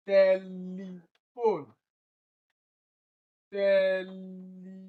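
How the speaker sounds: "Telephone" stretched out, TEL-e-phone; stress on first syllable. Shown as a demonstration. Teacher sounds out option B, very slow syllables. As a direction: draw out "Telephone" slowly from TEL. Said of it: "It is also tel that is stressed".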